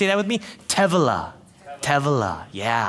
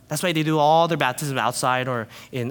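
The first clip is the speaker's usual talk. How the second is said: ordinary talk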